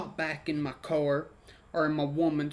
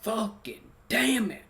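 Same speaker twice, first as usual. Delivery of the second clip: yelling at myself; 6 semitones higher, slower than usual. Retold or imitated. Cursing himself as the blue police lights show up, retold yell. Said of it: "I'm, I'm yelling at myself".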